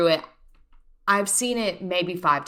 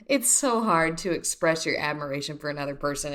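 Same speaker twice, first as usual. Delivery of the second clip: ordinary talk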